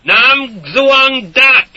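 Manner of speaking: slow, loud, each word apart. A narrator's reading. Soldier reading phrasebook to villagers, loud and slow. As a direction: spell it out slowly for them. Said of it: "separating each word".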